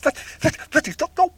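sudden loud alarmed gasping words; startled frightened shout; panicked cry. Acted. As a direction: panic as the water rises. Shrinking man panics in water.

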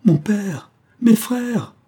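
high, overwhelmed shout to loved ones; frantic cry. A narrator's reading. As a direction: cry out to family, beside yourself. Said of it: "cria le jeune homme éperdu".

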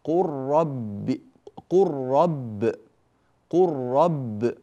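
قل رب said qurrab, lam swallowed by a doubled ra; slow, drawn qurrab with a rolled double ra; teacher's slow merged قل رب. Shown as a demonstration. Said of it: "اللام في الراء".

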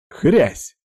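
high, punchy spoken smack; imitated crunch. A narrator's reading. Narrator sounding a tile smashing the thug's face, punchy crunch. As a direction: land it like a blow.

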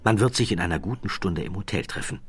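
ordinary delivery

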